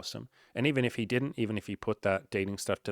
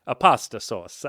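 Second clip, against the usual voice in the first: hammed-up Italian accent, slow and loud; mock Italian accent; 3 semitones higher, 8 dB louder, slower than usual. Host jokes to listeners about his Italian, in a hammed-up Italian accent. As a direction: stretch each Italian word out like a cartoon Italian. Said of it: "love that accent".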